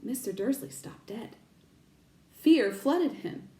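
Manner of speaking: ordinary delivery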